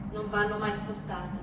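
ordinary delivery

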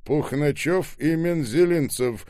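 loud, high announcement; shouted names. A narrator's reading. Ребров insisting on two surnames over Скоба's yells, loudly. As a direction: call the surnames out forcefully. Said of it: "что вы кричите".

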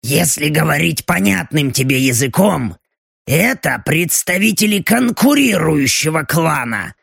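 clanging metal in an otherworldly voice; Belochka's metallic demon voice. Acted. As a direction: speak like a demon with steel in its throat. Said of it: "В голосе демона снова лязгал металл".

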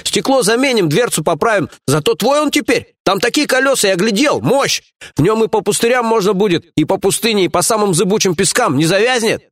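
high-pitched, eager sales patter; enthused underling. A narrator's reading. Lieutenant sells the boss on the truck, enthused. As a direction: talk the prize up excitedly, high and keen. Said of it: "воодушевился Чеченя".

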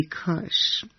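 breathy whisper of a wish; whispered regret. Acted. Child whispering a regretful wish to himself. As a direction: let the word slip out as a whisper. Imagined regret, self-talk.

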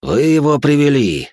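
high, loud, drawn-out delight; joyful exclamation. A narrator's reading. Mockingbird greets the captive's arrival, joyful cry. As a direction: exclaim it with open joy. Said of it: "радостным голосом произнёс пересмешник".

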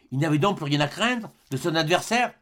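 ordinary delivery

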